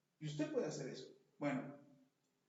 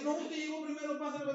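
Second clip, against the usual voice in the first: higher, insisting politely; mock polite young man; 9 semitones higher, 5 dB louder than usual. Young man in the shop insists the pastor go first, higher and polite. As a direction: act the young man courteously waving him on.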